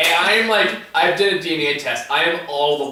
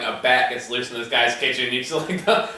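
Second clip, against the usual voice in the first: usual speaking voice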